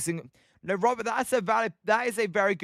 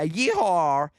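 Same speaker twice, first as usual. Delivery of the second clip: lightly voiced cowboy whoop; a hint of a cowboy yeehaw; slower than usual. Streamer plays cowboy for a viewer, mock yeehaw. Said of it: "I sound like a cowboy".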